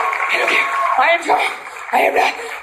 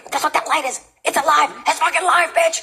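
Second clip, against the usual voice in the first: usual speaking voice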